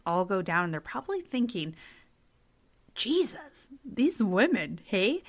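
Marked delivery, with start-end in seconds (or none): 2.9-5.2 exasperated, pitched-up guy voice; a hint of a baffled husband; bemused man voice. Husbands' imagined thoughts voiced.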